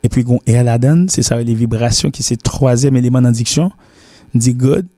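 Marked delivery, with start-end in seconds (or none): none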